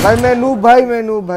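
panicking gamer. Real, not acted. Gamer panicking mid-fight.

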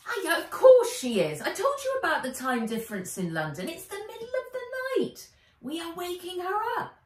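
put-on high, brisk know-it-all girl; Wen, bossy friend. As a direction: talk over the boy, sure of yourself. Wen voiced in story.

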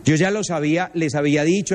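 ordinary talk

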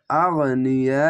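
আগনিয়া drawn out very slowly with a rising end; slow recitation. Shown as a demonstration. Teacher shows pupils how to read আগনিয়া, slow recitation. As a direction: stretch আগনিয়া slowly, Quran style. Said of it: "আগ এভাবে হবে".

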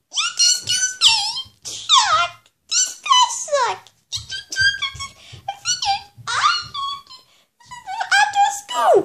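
very high, shrill cartoon yell; high-pitched puppet rant; squeaky angry character. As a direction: shriek insults in a tiny high voice. High-voiced character yelling at Chuck.

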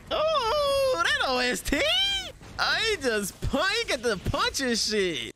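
high, drawn-out whoop of delight; thrilled hype. Real, not acted. Fan hearing the soundtrack, thrilled high whoops. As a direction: stretch it out high, hyping the music.

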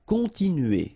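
Continuer with nasal on and a French u; kon-tee-nu-ay, nasal on; French word. Shown as a demonstration. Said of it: "nasal, continue".

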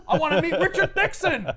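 mock-hysterical high, loud whine; Talbot's meltdown. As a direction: wail it like a fan in meltdown. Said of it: "Talbot is meltdown about the president".

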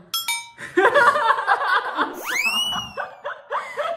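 shrieking burst of laughter; loud laughter. Real, not acted.

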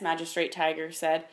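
ordinary delivery, nothing marked

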